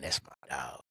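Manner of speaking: normal talking voice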